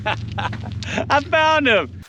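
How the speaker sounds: high, drawn-out joking reveal; mock triumph. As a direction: stretch it out high for the punchline. Punchline of lost marbles gag.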